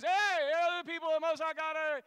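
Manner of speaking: high, loud mocking yell of the girl; hollering from behind them; shouting. Girl yelling at Paul outside a building, loud high yell. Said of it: "here she is".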